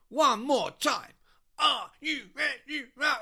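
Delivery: slow, high bellow, word by word; huge MC holler; shouting to crowd. Acted. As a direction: punch out each word to the back row. MC's last try, shouting.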